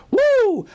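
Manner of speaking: high, loud wrestler's woo; Ric Flair woo. Pretend. Stock host shouts a wrestler's woo to the chat. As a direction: let out a piercing Ric Flair whoop. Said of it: "Ric Flair".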